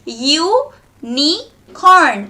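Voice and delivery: U, NI, CORN in three slow beats with pauses; broken into syllables. Shown as a demonstration. Teacher demonstrates jaw hitting hand thrice, U - ni - corn broken apart. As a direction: separate U, ni, corn so each drops the jaw. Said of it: "Thrice your jaw hits your hand".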